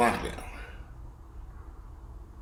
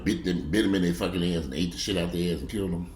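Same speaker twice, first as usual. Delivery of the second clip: usual speaking voice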